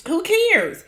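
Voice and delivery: high, scoffing who cares; dismissive. Shown as a demonstration. Vlogger scoffing at the cast's worries, high and dismissive. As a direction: toss it off, mocking anyone who would care. Repeats it, pitched way up.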